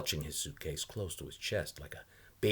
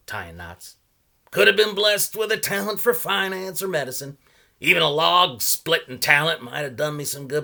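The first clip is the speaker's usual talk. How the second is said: put-on a higher, louder voice for the odd old salesman; old man pitching his wares, higher and louder; 8 semitones higher, 11 dB louder than usual. A narrator's reading. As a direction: play the chatty old knot peddler. Salesman's voice in read story.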